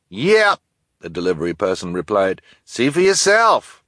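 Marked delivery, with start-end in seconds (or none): 0.1-0.6 delivery person voice. Acted. 2.6-3.8 high, loud, slow delivery man; delivery person voice. Acted.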